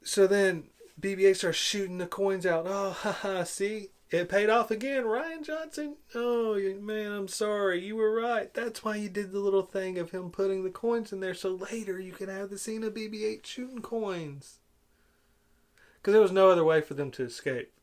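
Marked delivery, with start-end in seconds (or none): 2.7-14.6 high-pitched fake gushing; mock-delighted, higher-pitched false contrition; sarcastic mock apology. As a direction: pretend to be won over, dripping with sarcasm.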